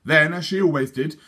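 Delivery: plain reading voice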